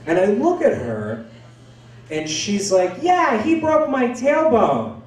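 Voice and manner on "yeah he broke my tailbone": high, slow mother's complaint; complaining mother. Retold or imitated. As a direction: drag it out high, airing the grievance. Mother complaining before girlfriend.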